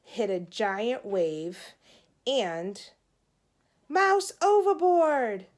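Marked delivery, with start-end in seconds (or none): 3.9-5.5 high, loud, slow cry of alarm; daydream shout. Acted. Judy in her daydream shouts the alarm at sea, loud and high. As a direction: yell out over the crashing waves.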